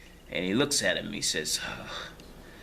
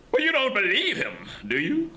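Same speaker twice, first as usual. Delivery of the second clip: high mocking voice; 12 semitones higher, 4 dB louder than usual. Prisoner teasing the old man.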